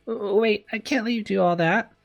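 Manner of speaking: high-pitched, stammered objection; flustered protest. Acted. Patient protesting to her carer.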